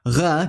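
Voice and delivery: loosen g into a throaty gh on "R"; voiced g fricative. Shown as a demonstration. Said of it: "pronounced in four different ways".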